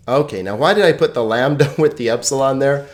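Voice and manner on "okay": normal talking voice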